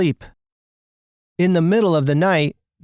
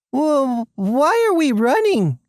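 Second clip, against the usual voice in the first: high-pitched questioning voice; acted confusion, pitch raised; puzzled protest; 8 semitones higher, slower than usual. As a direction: ask it high, in bewildered protest. Character questions the escape.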